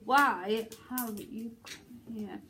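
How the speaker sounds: slow, stretched plea; long and drawn out. Shown as a demonstration. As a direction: stretch the words right out. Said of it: "do it long this time".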